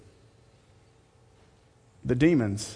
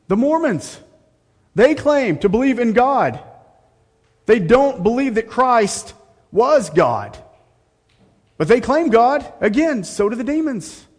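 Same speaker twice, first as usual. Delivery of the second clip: mocking sarcasm; 10 semitones higher, 8 dB louder than usual. Preacher mocks false religions.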